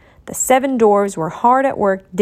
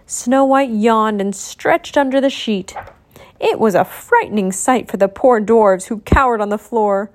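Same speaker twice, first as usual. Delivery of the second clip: plain reading voice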